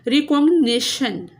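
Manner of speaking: Recognition with a loud stress on "नि"; teacher's stressed recognition, punching "नि"; syllable stress demo. Shown as a demonstration. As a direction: lean hard on the "नि" of recognition. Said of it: "NI पे stress है".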